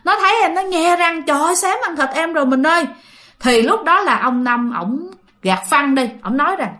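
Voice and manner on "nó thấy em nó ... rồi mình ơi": high, urgent acted fright; alarmed retelling. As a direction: play a frightened wife telling her husband, pitched up. Vợ hoảng kể chuyện gặp cọp. (giọng cao, hốt hoảng kể lại)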